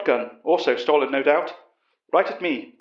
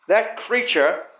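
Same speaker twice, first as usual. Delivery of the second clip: upset outburst, a touch raised; cried out, upset; 5 semitones higher, much slower than usual. A narrator's reading. Upset major answering the professor, understated outburst. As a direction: push back at the question. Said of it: "Utterson cried".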